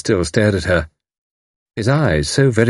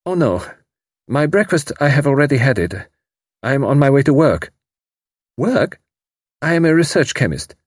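stiff formal; 6 semitones higher than usual. A narrator's reading. Voices Karl.

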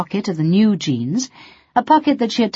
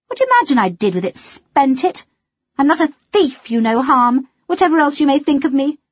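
bitter; 7 semitones higher, 3 dB louder than usual. Acted. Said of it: "said Melody rather bitterly".

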